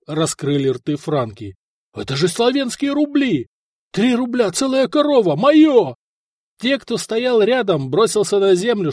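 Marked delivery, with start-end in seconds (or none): 1.9-5.9 high-pitched, greedy excitement; excited exclaiming. A narrator's reading. Soldiers spotting silver roubles in the dust, excited. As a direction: build to a loud grabbing cry.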